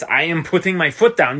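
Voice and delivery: ordinary delivery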